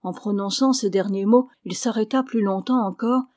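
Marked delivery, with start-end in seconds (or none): none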